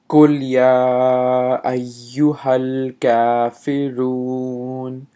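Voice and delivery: slow, chanted recitation; Quran recitation. Acted. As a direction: recite the verse slowly, chanted. Recites the verse.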